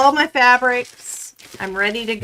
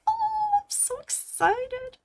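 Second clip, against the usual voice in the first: mock giddy; 6 dB quieter than usual. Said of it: "I was like".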